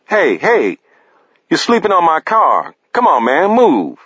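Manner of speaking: yelling to wake him. Acted. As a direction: shout him off the car. Paris yelling at sleeper.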